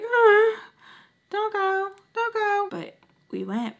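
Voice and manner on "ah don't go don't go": small, high-pitched inner voice; high warning voice. Acted. Her gut warning her off the visit, high-pitched. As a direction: squeak out a worried little warning.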